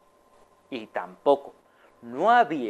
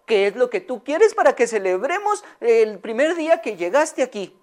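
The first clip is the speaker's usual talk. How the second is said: high, doting lady's voice; Señora Amelia; 7 semitones higher than usual. Acted. Owner cooing high to her beloved hen. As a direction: coo sweetly up high at her pet hen. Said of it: "le dijo".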